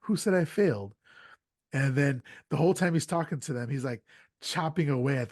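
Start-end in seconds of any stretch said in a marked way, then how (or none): 0.0-0.9 indignant retort. Retold or imitated. Offended character retorts indignantly.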